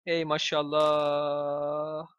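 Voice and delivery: very slow stretched mashallah; slow mock blessing; drawn-out sarcastic. Real, not acted. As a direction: drag out Ey maşallah sarcastically.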